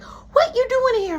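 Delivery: put-on very high, loud childish voice; squeaky high upset kid; upset girl voice. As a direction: play a sulky young girl.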